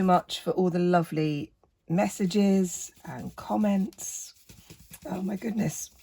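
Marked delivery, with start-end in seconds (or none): none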